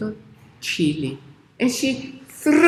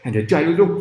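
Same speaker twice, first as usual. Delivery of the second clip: plain reading voice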